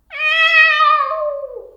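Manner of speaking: very high, loud yowling meow; piercing cat yowl; loud cat cry. Shown as a demonstration. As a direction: wail like an upset cat. Demonstrating the cat's cry.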